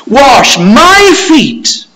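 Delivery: high, loud, scandalized protest; aghast Peter protesting. Acted. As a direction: cry out in disbelief, leaning on the pronouns. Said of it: "Peter is saying in effect that".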